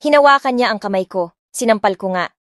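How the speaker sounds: usual speaking voice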